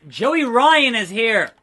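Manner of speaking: loud, slow, drawn-out match call; excited announcement. Pretend. Announcing Joey Ryan's run-in, excited. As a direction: proclaim the surprise arrival.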